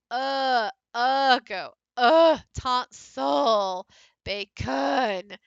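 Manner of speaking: uh drawn out loud and slow; lazy schwa demo. Shown as a demonstration. Teacher showing students the lazy schwa. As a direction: show the lazy uh sound. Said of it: "it makes the vowels lazy".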